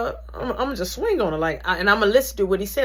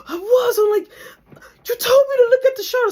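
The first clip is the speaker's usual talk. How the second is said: crying protest; 9 dB louder than usual. Retold or imitated. Upset reply to the instructor.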